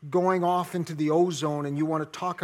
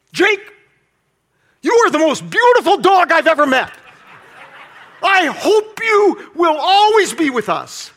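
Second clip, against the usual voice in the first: loud, very high barked shout; harsh yelling tone; 13 semitones higher, 16 dB louder than usual. Shown as a demonstration. Owner shouting sweet words harshly at his dog. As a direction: yell the compliments like a furious scolding. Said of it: "It all has to do with my tone".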